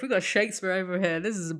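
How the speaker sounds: usual speaking voice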